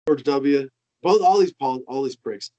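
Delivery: usual speaking voice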